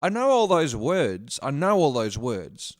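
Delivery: slow, loud, baffled repeat; mock bewilderment, loud and slow. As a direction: drag it out like you can't believe it. Mocking own baffled reply.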